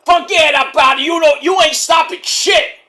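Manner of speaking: defiant wrestler brushing off the referee; tough guy retort. Retold or imitated. Wrestler telling the referee he won't stop, defiantly. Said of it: "Tony's like".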